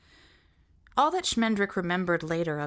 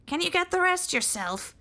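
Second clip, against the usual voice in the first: sentry voice; 12 semitones higher than usual. Acted. Sentry high voice continues.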